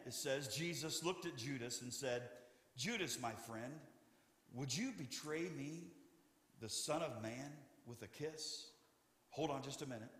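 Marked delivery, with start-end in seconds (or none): 2.8-8.7 soft, gentle and hushed; hushed tender plea, fading even softer. Acted. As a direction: speak gently to a friend who betrays you. Jesus speaks gently to his betrayer.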